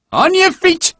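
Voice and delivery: high, loud barked order; drill shout. Acted. Sergeant rousing the marching men, high loud shout. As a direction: bark it at the men. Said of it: "Sergeant Havercamp shouted".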